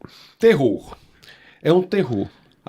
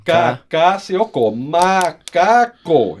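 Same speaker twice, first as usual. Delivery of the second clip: slow spelled out; much slower than usual. Shown as a demonstration. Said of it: "Como é que você aprendia?".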